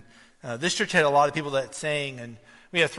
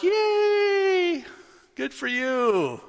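very high, drawn-out "yay"; overdone congratulations in a high voice; mock cheer; 5 dB louder, much slower than usual. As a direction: cheer with forced delight, stretching the words. Mock cheering a classmate's check.

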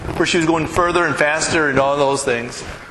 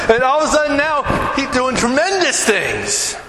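ordinary delivery

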